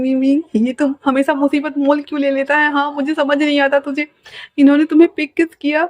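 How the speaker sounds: put-on young man panicking alone over his mistake; fretful panic. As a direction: scold yourself in a fluster. Wing frets after fainting Wangji.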